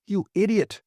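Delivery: high, sharp angry retort; the superintendent insulting his boss; snapped insult. A narrator's reading. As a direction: spit out the insult in contempt. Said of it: "snapped Warren".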